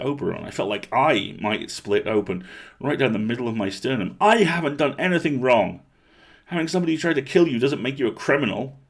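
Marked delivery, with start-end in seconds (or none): 4.2-8.8 louder, higher indignant outburst; angry protest. A narrator's reading. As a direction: let the anger crack out of the chest. Angry girl defends herself.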